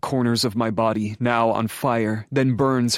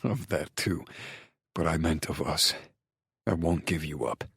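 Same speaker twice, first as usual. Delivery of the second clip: soft, lowered man's voice; tender male lover; 5 semitones lower, 7 dB quieter than usual. A narrator's reading. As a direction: promise it quietly and intimately to a lover. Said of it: "He laughs".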